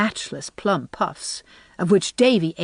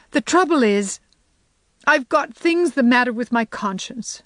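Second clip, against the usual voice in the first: sobbing; 5 semitones higher than usual. Acted. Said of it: "sobbed Anne".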